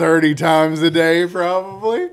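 emphatic exclamation. Real, not acted. Speaker imagining what the nurse hears, emphatic. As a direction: drive it home loudly, rising to the end. Exasperated point about nurse's experience.

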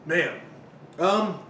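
speaking while chewing; mouth partly full. Real, not acted. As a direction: fingers over lips. Seen 1: After a pizza bite, fingers rest on lips while he speaks.

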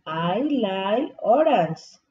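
slow, clear classroom model of I like strawberry; slow model sentence. Shown as a demonstration. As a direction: say it slowly for the kids to copy. (despacio y claro, como modelo)

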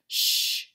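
unvoiced shush. A narrator's reading. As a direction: hush the noise. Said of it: "said Leroy".

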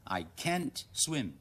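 I can't swim said slowly, can't as kant with flat A; slow, careful American I can't swim; slow American reading. Shown as a demonstration. Teacher modelling American English, slow reading. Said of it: "美語啦".

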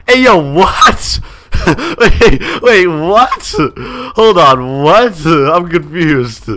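laughing, bewildered surprise; stunned disbelief. Real, not acted. Shocked she knows who he is.

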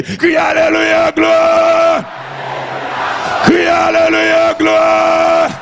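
high, sustained chanted shout; praise cry. Real, not acted. Preacher leading the cry of the saved, chanted shout. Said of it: "c'est le cri des sauvés".

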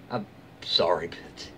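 whispered apology under the breath; a real whispered sorry. Real, not acted. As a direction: breathe it out, no voice at all. Reader apologising mid-reaction.

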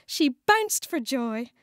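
usual speaking voice

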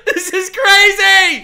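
high, slow, laughing almost crying; laughing disbelief. Real, not acted. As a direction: lose it while saying it.

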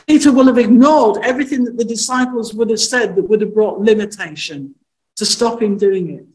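ordinary talk

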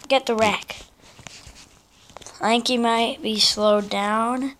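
ordinary talk, nothing marked

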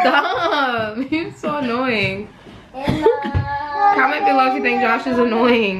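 laughing through the words; giggling at the joke. Real, not acted. Laughing at teasing husband.

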